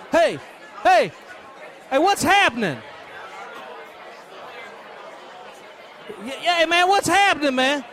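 beggar calling out to the passing crowd. Acted.

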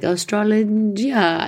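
mock Italian boatman; imitating Italian man. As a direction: sound out the word like a puzzled Italian guide. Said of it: "finally he's like".

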